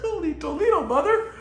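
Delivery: put-on high, laughing gee-whiz kid voice; wholesome 1950s kid. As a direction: exclaim like a 1950s sitcom kid. Mock old-timey kid exclamation.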